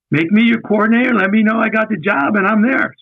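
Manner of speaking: high-pitched, quick push for the job; eager retold demand. Candidate pressing boss for the job, eager and high.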